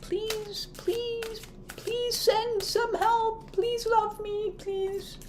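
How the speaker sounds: high, soft whimpering plea; high, soft, slow begging voice; pleading. Retold or imitated. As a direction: beg weakly like a needy child.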